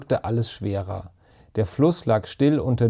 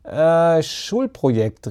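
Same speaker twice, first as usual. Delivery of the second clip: caught-out excuse; 5 semitones higher, much slower than usual. A narrator's reading. Ben invents an excuse for the neighbour, caught out. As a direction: blurt an Äh, then stretch the excuse. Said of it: "rief Ben viel zu schnell".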